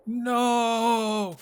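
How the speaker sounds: anguished cry. Acted. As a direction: draw it out as a dramatic wail of loss. Mock mourning of the general.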